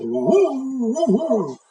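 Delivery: high hooting wolf yelp; wolf's wail. Acted. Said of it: "the wolf said".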